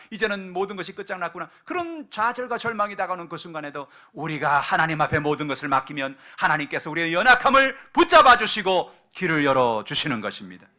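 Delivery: full-volume sermon; preaching at full force. Real, not acted. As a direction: build to a fervent climax. Preaching climax.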